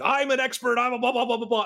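high, huffy professor; mock indignant professor. Mocking the irate professor.

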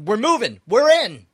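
rising to a loud high "we're in"; triumphant shout. Pretend. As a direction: announce it like a victory cry. Decision shouted to the kids.

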